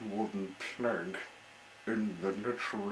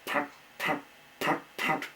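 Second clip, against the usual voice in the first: high breathless put put sputtering; engine sputter; 7 semitones higher, 3 dB louder than usual. Acted. The little engine sputters, imitated high and breathy. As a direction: mimic a dying motor.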